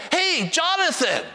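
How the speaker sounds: high, drawn-out holler; retold yell. Grandpa calling to his grandson through the crowd, yelling high. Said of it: "And so I yelled".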